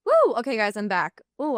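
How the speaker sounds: ordinary delivery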